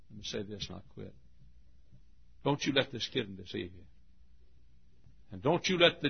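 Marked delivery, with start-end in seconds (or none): none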